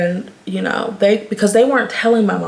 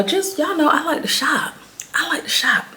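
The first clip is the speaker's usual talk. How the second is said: playful; 8 semitones higher than usual. Real, not acted. Playful confession about shopping.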